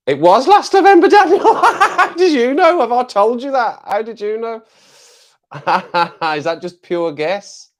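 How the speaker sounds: very high, loud squeal through laughter; amazed outburst. Real, not acted. Streamer stunned by viewer's correct guess, amazed laughing squeal. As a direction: shriek in laughing disbelief.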